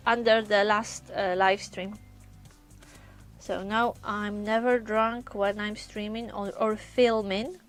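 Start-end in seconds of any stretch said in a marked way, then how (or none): none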